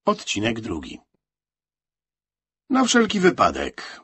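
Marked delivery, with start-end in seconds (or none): 2.7-4.0 high, loud quick justification; high-pitched, louder answer; insistent answer. A narrator's reading. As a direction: offer it up in a raised voice. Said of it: "powiedział Franek".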